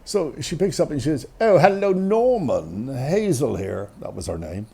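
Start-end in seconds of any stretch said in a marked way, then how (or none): 1.4-3.9 mother's formal phone voice, high bright-rising hello; doctor's voice. Retold or imitated. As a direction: put on a grand telephone voice. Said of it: "a doctor's voice".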